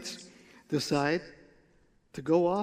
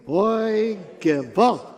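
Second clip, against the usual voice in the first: loud gewalt; 3 semitones higher, 4 dB louder, much slower than usual. Shown as a demonstration. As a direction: lead the crowd in a loud lament. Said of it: "You gotta say the Gewalt loudly".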